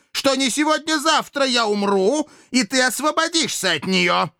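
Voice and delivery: high, strained enraged tirade; furious accusation. Acted. Dictator confronts the man who mocked his sentence, furious. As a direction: hurl the accusations in a high-pitched rage.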